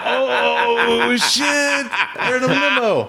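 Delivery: very high, drawn-out excited cry; amazed whooping. Retold or imitated. Racers learning the limo is their ride, excited mock shout. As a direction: hoot it in laughing amazement.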